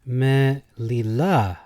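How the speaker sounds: "me lila" very slow, LA punched; meh-lee-LAH, drawn out; stress on last syllable. Shown as a demonstration. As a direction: slowly land hard on "la" in "me lila". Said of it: "Emphasis on the last".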